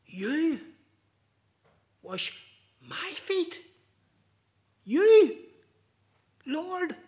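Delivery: high, broken, incredulous questions; stunned pauses between each word; astonished disbelief. Retold or imitated. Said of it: "utter astonishment and unbelief".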